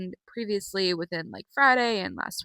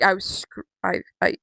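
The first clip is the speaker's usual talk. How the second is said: ordinary talk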